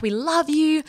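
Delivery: high-pitched mock fan adoration; gushing fans. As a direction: imitate a starstruck fan. Retold fans declaring love.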